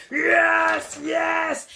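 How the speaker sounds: loud, high joyful roar; roar with excitement. Acted. The lion roars with excitement at the falling carrots. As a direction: roar out your delight. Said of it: "he began to roar with excitement".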